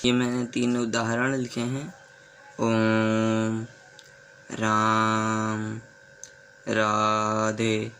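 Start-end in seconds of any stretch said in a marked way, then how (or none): 2.6-7.9 ओम, राम, राधे with the vowel stretched very long; chant-like drawn-out ओम; prolated vowel demo. Shown as a demonstration. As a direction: drag the vowel out slowly. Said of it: "ध्वनि थोड़ी लंबी खींच रही है".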